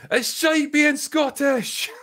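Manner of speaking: put-on high, slow, full-force shout in a Scottish accent; Scottish yell. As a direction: yell the Trainspotting line, drawn out and high. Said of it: "And Drinker yells".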